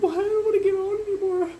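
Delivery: very high, put-on girly whine; mock scared girls. Retelling girls on a loose ride, mock high-pitched girl voice. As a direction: mimic girls in a squeaky high voice. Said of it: "girls like".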